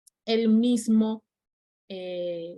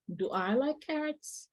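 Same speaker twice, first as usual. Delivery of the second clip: slow, soft, higher teaching read; slow model sentence; 5 semitones higher, 7 dB quieter, slower than usual. Shown as a demonstration. Teacher reading the example softly to students, slowly and higher. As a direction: lift the question gently for learners. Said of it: "el verbo do".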